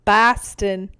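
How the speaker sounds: loud mock Boston accent; lightly put-on Boston voice. Shown as a demonstration. Host copies her friend's Boston accent. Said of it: "That's Boston".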